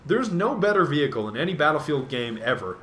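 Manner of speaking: ordinary talk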